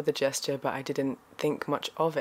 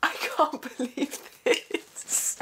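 higher, slow, breathless laughing disbelief; disbelieving laugh; 12 semitones higher, much slower than usual. Real, not acted. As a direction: giggle the words out, overwhelmed. Overwhelmed, laughing at the gift.

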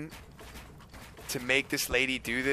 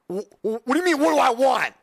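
raised, loud outraged dad retort; indignant dad; 9 semitones higher, 5 dB louder than usual. Retold or imitated. Son retelling dad at the drive-thru, indignant. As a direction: stammer into an indignant, high-pitched rant.

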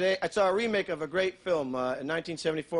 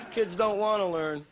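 ordinary talk, nothing marked

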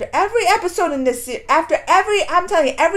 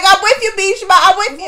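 cheering agreement; 4 semitones higher, 7 dB louder than usual. Retold or imitated. Cheering Bhishma on, laughing.

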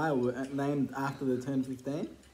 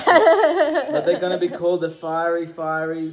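laughing; 2 semitones higher, 10 dB louder than usual. Real, not acted. Laughing pitch of product name.

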